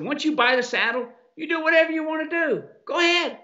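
high, mocking go ahead; dismissive retold reply. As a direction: brush the caller off in a high mock voice.

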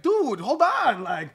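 loud, high, slowed-down pleading; dazed plea. Retold or imitated. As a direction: stall him, slow and loud, begging a moment. Dazed after punches, stalling attacker.

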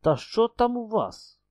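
put-on frightened old mother, pitch shooting up; anxious mother pleading with her son; alarmed high cry. A narrator's reading. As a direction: blurt it out in alarm.

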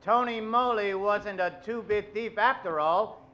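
usual speaking voice

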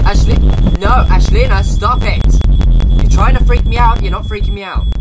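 scared shouting; loud rattled yell. Real, not acted. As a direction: yell to hide the fear. Yelling at ghost character.

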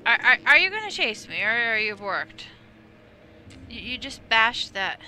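loud, fast, panicked stammer; being chased. Real, not acted. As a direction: blurt it out as the monster comes at you. Enemy starts chasing the player.

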